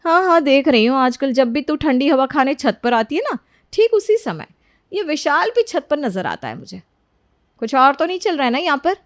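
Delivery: suspicious sister-in-law. Acted. Sister-in-law hinting at Vishal on the roof, high-pitched suspicion. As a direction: probe her with knowing suspicion.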